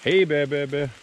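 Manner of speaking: loud high warning call; scaring off a bear. Real, not acted. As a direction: call out to alert any bears. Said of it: "So I'm singing the Hey Bear Bear song".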